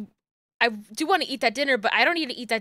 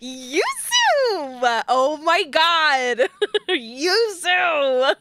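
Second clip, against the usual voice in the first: drawn-out, delighted squeal; excited squeal; 4 semitones higher, 4 dB louder, much slower than usual. Real, not acted. Fan reacting to Yuzu on stream, excited squeal. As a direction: cry out with laughing delight.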